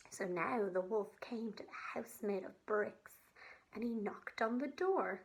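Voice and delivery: gentle, expressive storytelling for little ones; sensory story read aloud for kids; reading to children. Acted. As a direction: tell it like a bedtime story to a child.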